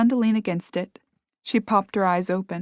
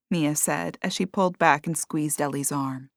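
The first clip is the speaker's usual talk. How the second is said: ordinary delivery, nothing marked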